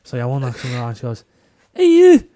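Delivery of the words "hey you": high, loud Scottish woman giving out; Scottish accent. Retold or imitated. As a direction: shout it as an outraged Scottish neighbour, pitched way up. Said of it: "the way they say garden is the funniest thing ever".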